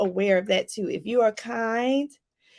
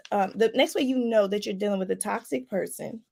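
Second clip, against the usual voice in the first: ordinary talk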